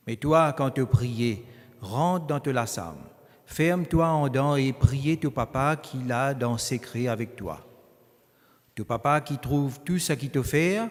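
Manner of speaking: normal talking voice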